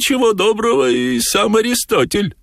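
high-pitched storybook figure speaking; высокий голос персонажа. A narrator's reading. Cat's speech in narrated story. (высоким сказочным голосом)

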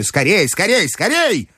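high, rising urgency; impatient grandmother. A narrator's reading. Grandmother rushing back to gamble, impatient. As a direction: hurry them along in a high granny cry.

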